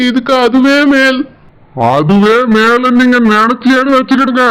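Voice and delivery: lightly voiced menacing elder brother; a hint of a stern, threatening brother; stern threatening brother-in-law. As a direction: threaten him as the wife's protective brother.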